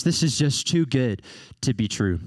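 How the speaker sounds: ordinary talk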